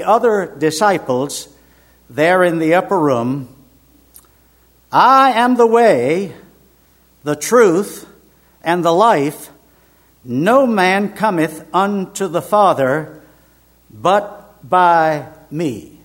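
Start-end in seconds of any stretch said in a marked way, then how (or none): 4.9-16.0 loud, slow, emphatic declaration; ringing scripture quotation, each phrase hammered home. Retold or imitated. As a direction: proclaim it phrase by phrase with weighty pauses. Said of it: "Now that is emphatic".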